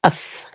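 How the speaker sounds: "Eth" with a soft hissing th; sustained dental th; th sound. Shown as a demonstration. Teacher isolates the th sound. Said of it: "S lemah".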